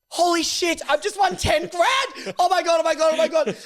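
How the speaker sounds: high, loud overjoyed yelling; mock-ecstatic high squeal; retold excited shriek. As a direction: shriek like you just won big. Boyfriend wins scratchy, retold.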